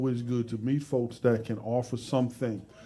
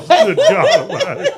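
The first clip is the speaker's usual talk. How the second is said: squealing laugh, much higher and louder; 11 dB louder, much slower than usual. Real, not acted. Host cracking up at his own story, laughing. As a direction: howl it out through laughter.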